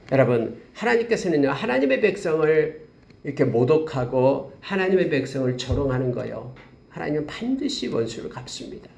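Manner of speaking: ordinary talk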